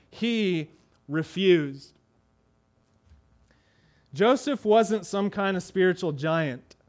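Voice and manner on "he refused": "He refused." said very slowly, each word held; slow emphatic quote. Shown as a demonstration. Preacher driving home the key words to his flock, very slow. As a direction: spell out "He refused." as the words to remember. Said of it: "you remember those two words".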